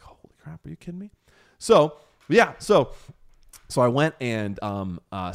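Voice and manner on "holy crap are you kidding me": a breathless, amazed hush; whispered holy crap in awe; stunned whisper. Retold or imitated. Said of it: "I was like".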